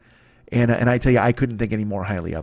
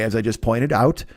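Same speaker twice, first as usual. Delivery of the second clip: ordinary talk, nothing marked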